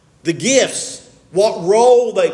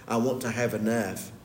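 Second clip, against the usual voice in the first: a soft, lower, inward murmur of need; hushed clinging voice; 8 semitones lower, 10 dB quieter than usual. Retold or imitated. Preacher voicing Depression-era hoarders, hushed and lower. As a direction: voice their quiet fear of going without.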